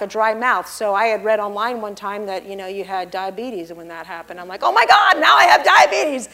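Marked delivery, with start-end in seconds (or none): 4.6-6.3 high, loud mock panic over a health scare; panicked cry. Telling the church about her panic attack, high alarmed cry. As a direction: shout it like you're sure you're dying.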